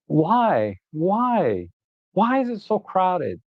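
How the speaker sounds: high-pitched, pleading exasperation; drawn-out, worked-up wail of frustration; exasperated. Real, not acted. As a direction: demand an answer in despair at the traffic. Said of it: "I gotta relax".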